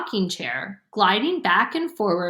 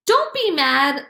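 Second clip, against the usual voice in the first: pleading; 7 semitones higher than usual. A narrator's reading. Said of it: "Libby pleaded".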